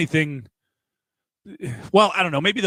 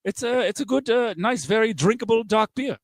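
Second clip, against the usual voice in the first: higher-pitched mock German accent; put-on German, pitched up; 7 semitones higher than usual. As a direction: review it in a playful German accent. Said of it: "But in a German accent".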